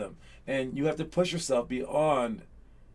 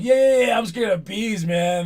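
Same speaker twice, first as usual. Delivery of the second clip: higher, louder, hammed-up mock cheer; mocking agreement; 5 semitones higher, 6 dB louder than usual. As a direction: jeer back "I'm scared of bees" like teammates making fun. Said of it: "And everyone's like".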